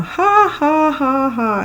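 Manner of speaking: high, hammed-up fake laugh "ha, ha, ha"; mock party laugh. Telling friends her drama at a party, a high hammed-up fake laugh.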